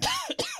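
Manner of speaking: hacking chesty cough. Real, not acted. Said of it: "I can't stop coughing now".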